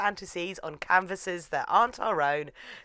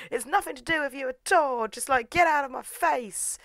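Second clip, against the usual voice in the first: higher-pitched mock indignation; acted angry brush-off; 9 semitones higher than usual. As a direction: snap it like a play-acted tiff.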